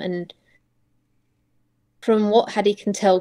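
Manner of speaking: ordinary talk, nothing marked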